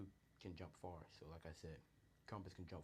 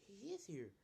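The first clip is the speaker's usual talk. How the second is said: high, loud happy announcement; acted delighted exclamation; excited; 13 semitones higher, 5 dB louder than usual. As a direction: burst out with it, pitch leaping up. Inko delighted son has arrived.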